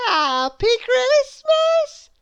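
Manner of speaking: put-on very high, slow squeaky little girl voice; a tiny cheerful girl, drawn out high; Little Miss Contrary. A narrator's reading. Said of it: "said little Miss Contrary".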